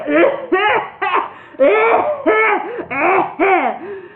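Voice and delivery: loud high laughter; laughing fit. A narrator's reading. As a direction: laugh helplessly. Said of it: "The wizard concluded".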